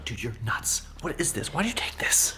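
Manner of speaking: whispering. Acted. Hiding from the monster.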